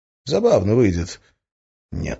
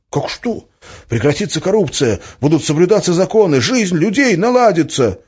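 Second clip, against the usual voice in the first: indignant; 11 semitones higher, 6 dB louder than usual. A narrator's reading. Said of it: "запыхтел от возмущения".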